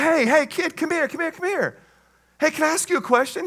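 high-pitched coaxing call; calling a kid over. Acted. Guest calls the kid over.

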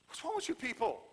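high-pitched, incredulous scolding; exasperated, voice leaping up at the sleepers; upset Jesus. Acted. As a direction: scold them in disbelief. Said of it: "he gets upset with them".

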